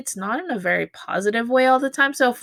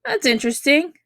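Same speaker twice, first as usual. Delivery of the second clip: higher, a bit louder, impressed and curious; pleasantly surprised; 7 semitones higher, 4 dB louder than usual. Shown as a demonstration. Teacher voices a friend admiring a drawing, pleasantly surprised. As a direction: react as if you just spotted their talent. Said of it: "but more of".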